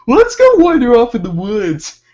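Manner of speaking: bright eager suggestion; eager kids. Retold or imitated. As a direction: pitch it up like a kid who's found an adventure. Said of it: "my cousins were like".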